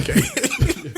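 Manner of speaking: high, loud, laughing disbelief; real shocked laugh on one word; stunned laughing okay. Real, not acted. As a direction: react to the shock with a squeal. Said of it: "That was a wild twist".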